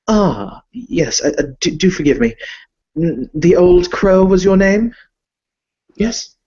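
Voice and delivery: refined vampire lord greeting a guest; Viscount Alexander Harkin. Acted. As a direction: play a polite, fussy nobleman. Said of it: "the Viscount himself seated behind".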